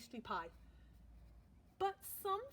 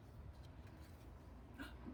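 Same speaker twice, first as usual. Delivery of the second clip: long unvoiced gasp; breathy anticipatory gasp; 14 dB quieter than usual. A narrator's reading. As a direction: draw in a long excited breath. Before final round.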